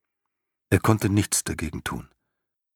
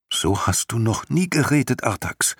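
higher, surprised question to his horse; astonished; 6 semitones higher than usual. A narrator's reading. Boy Atreo startled by his despairing horse, astonished. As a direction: sound taken aback by the change in him. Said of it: "meinte Atreo erstaunt".

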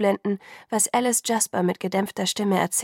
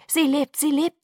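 higher, louder insistence; forceful inner thought; 7 semitones higher, 4 dB louder than usual. A narrator's reading. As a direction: think it as hard as you can. Alice voicing her thought forcefully. (eindringlich, als gedachter Ruf)

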